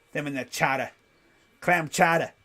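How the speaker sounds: put-on r-dropping Boston accent on "chata"; joking Boston chowder talk. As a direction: drop the r like a Boston local. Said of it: "we have the Boston accent".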